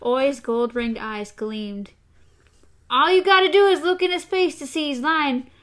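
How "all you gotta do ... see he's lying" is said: rough accusing voice. A narrator's reading. Gasher accusing Hoots, voiced.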